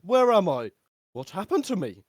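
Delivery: high, loud bewildered voice; dazed character voice. Acted. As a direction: wake up lost and loud. GM voicing a groggy character.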